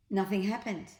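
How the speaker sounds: usual speaking voice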